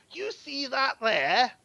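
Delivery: grand menacing proclamation. Acted. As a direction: proclaim the warlord's toll like a herald. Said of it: "And he goes".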